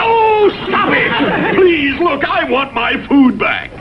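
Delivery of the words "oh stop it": high, slow exasperated cry; drawn-out cry of exasperation. Acted. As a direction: throw up your hands, stop it. Exasperated at tongue-twister argument.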